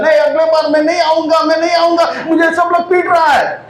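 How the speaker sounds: high-pitched mock wail of protest; complaining football. Football tells audience it won't come back, high mock-crying protest. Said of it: "football क्या रोके हुए कहता है".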